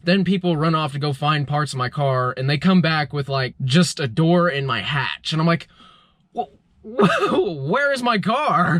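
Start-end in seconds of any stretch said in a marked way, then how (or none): 6.3-8.8 laughing disbelief. Retold or imitated. Panicked search for his car.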